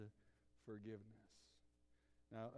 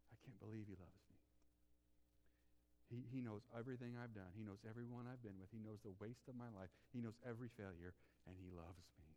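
much softer, lower, tearful-sounding whisper of the woman; hushed woman's confession; 5 semitones lower, 9 dB quieter than usual. Acted. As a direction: speak her broken inner thoughts barely aloud. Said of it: "woman's voiced inner words".

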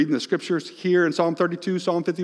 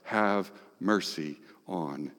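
quiet plea; 9 semitones lower, 9 dB quieter, much slower than usual. Retold or imitated. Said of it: "he says to God".

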